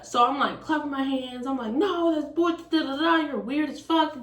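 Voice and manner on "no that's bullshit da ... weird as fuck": high-pitched mock rant; upset arguing. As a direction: re-enact an indignant outburst. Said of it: "I'm upset, I'm yelling".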